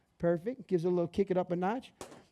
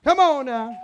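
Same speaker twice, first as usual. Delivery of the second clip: loud hype; 4 semitones higher, 10 dB louder, slower than usual. Real, not acted. Chef hyping up cooking start.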